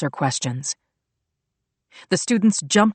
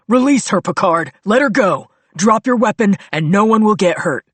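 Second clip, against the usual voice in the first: higher loud commanding yell; 5 semitones higher, 6 dB louder than usual. A narrator's reading. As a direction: bark the order at the gunman.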